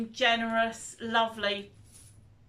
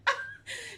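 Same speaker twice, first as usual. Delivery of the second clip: laughing sob; 13 semitones higher than usual. Real, not acted. Laugh tipping toward tears.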